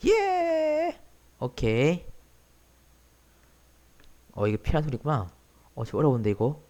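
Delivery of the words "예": excited shout. Real, not acted.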